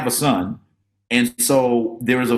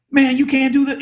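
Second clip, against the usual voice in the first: discouraging scold; 13 semitones higher, 4 dB louder than usual. Retold or imitated.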